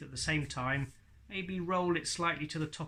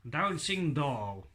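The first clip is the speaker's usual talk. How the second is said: very slow, careful "Dancing doll"; slow ventriloquist demo; much slower than usual. Shown as a demonstration. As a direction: draw out "Dancing doll" slowly, lips still. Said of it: "I said two words".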